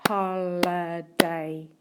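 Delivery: "Holiday" drawn out slowly, syllable by syllable; slow, syllable-by-syllable "Holiday" for a spelling lesson; broken into syllables. Shown as a demonstration. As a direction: stretch "Holiday" into three separate beats, as if clapping it. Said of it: "Can you clap that word".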